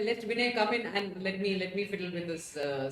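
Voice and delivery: usual speaking voice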